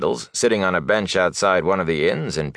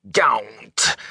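raspy warning; rasped; 4 semitones higher than usual. A narrator's reading. Frightened peddler silencing the young man, rasped. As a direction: rasp it out to stop him. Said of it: "he rasped".

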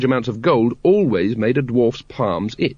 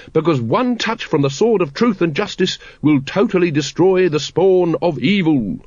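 higher, grandly proclaimed triumph; triumphant; 6 semitones higher than usual. A narrator's reading. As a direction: proclaim it like a victor, slowing at the end. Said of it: "said the Supreme Grand Master triumphantly".

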